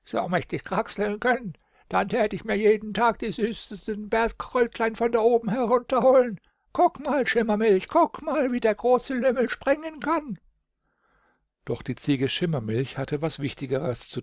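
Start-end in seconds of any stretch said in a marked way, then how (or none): 0.0-10.4 very high, excited billy-goat voice. A narrator's reading. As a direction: squeal it like an eager talking goat. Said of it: "Und Klebeis, der Geißbock, sagte". (hoher, aufgeregter Geißbock)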